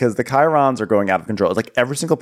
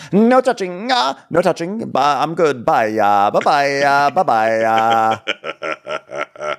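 high, exaggerated shooing goodbye; hammed-up bye-byes, pitched up; mock dismissal; 6 semitones higher, much slower than usual. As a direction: send up someone refusing to be touched. Acted dismissal of unwanted greeter.

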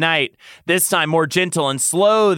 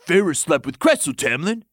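raised-pitch exclamation in character; exclaimed; 4 semitones higher than usual. A narrator's reading. Lucian confronts the lover over a secret, exclaiming. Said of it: "Lucian exclaimed".

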